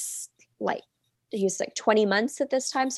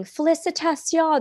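mock yell; 8 semitones higher than usual. French passers-by congratulating a labouring woman, high mock yell. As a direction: call it out high like passing well-wishers. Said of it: "they're, like, yelling".

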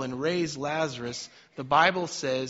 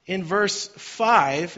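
slow emphatic reading; 3 semitones higher, 6 dB louder, much slower than usual. Shown as a demonstration. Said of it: "circle this and read this".

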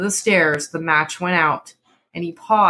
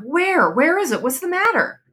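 alarmed; 10 semitones higher than usual. A narrator's reading. Startled husband woken by cry.

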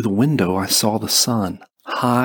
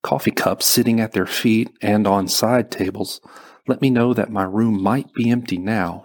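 usual speaking voice

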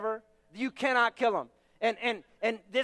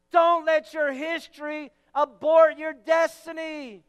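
ordinary delivery

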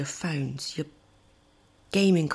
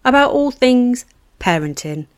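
loud, high announcer intro; big upbeat show opener; podcast intro; 6 semitones higher, 16 dB louder than usual. Acted.

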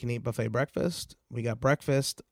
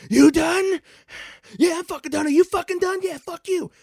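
high, loud, spat-out shouting; furious shouting; 7 dB louder than usual. Retold or imitated. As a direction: bark it breathless after the brawl. Brothers shouting after fight.

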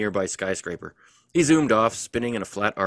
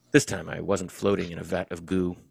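ordinary talk

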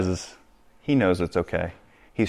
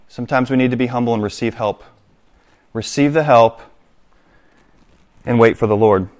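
normal talking voice

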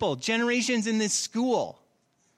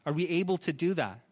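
normal talking voice

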